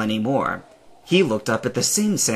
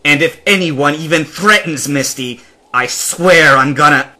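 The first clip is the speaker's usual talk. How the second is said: rising anger, louder and higher; louder heated threat that breaks off; angry vow; 4 semitones higher, 8 dB louder than usual. Acted. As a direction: threaten through the words. Stranger vows to protect Misty.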